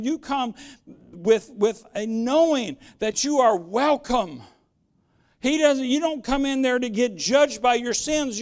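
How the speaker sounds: ordinary talk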